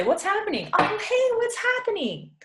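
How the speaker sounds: very high, cheerful hello call; high casual what's happening, like hey there; friendly greeting. Shown as a demonstration. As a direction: greet a buddy across the room. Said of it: "And it's a greeting".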